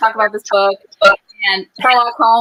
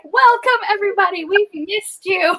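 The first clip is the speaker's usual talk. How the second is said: gushing welcome; 11 semitones higher than usual. Retold or imitated. Host greeting long-missed club members, gushing. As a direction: sing out the welcome well above normal pitch. Acts out greeting the returning viewers.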